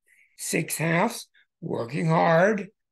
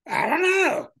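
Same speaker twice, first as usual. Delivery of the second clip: much higher, squeezed falling shrug; 13 semitones higher, 4 dB louder than usual. Retold or imitated. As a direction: toss it off with a high sigh. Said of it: "they can't answer their stupid questions".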